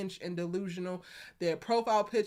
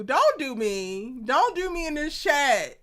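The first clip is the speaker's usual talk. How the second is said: slow, louder mock protest with a laugh; playful protest; 5 semitones higher, 6 dB louder, slower than usual. Real, not acted. Teasing a viewer in the chat, slow mock protest. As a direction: draw out the warning to the chat, teasing. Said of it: "Not you doing the two do and blue".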